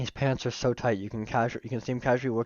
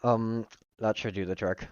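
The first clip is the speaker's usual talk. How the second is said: ordinary delivery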